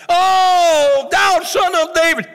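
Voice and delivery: high, loud pleading shout; Bartimaeus crying out. Acted. Blind Bartimaeus shouting to Jesus over the crowd, high and loud. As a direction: call out over the crowd, begging. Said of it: "Blind Bartimaeus said".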